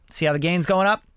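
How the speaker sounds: usual speaking voice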